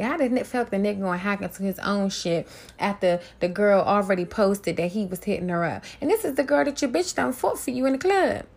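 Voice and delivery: ordinary talk, nothing marked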